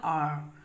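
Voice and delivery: "आर" dicho claro como "ar"; correct pronunciation. Shown as a demonstration. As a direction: remarca "आर" sin diptongo. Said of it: "इसे क्या पढ़ा जाता है".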